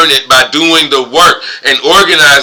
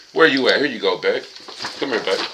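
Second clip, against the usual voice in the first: quieter, lower coaxing; murmured aside to a little animal; soft to pet; 5 semitones lower, 9 dB quieter than usual. Real, not acted. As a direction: coax the pet gently. Talking softly to pet.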